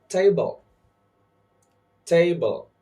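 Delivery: "table" with a high, loud TA, falling; stress on first syllable. Shown as a demonstration. Teacher saying words for learners to repeat, first syllable stressed. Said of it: "I will say the word and you repeat".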